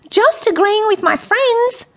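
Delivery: high-pitched innocent character voice; reply in a high, placating squeak; eager Michael. A narrator's reading. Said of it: "replied Michael".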